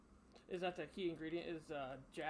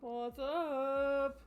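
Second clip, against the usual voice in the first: high, loud drawn-out what's up; loud greeting; 8 semitones higher, 8 dB louder than usual. Real, not acted.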